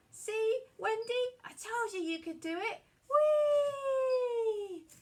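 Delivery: high, loud and jubilant, ending in a long "Whee"; triumphant whoop. Acted. Peter cheering Wendy on as they fly, high and loud. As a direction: crow with pride and soar.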